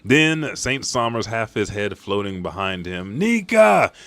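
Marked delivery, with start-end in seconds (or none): 3.2-3.9 high, louder sneering voice; a battered noble sneering the word Nika; mocking villain. Battered noble sneers at the legend, high and loud. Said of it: "he says".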